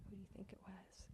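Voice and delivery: gentle voiced question, still hushed; quiet spoken repeat of the tease; soft voiced repeat.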